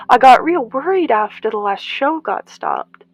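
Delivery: beam at them with delight; kindly old woman. Acted. Old gardener recognizes Rose's children, high and kind.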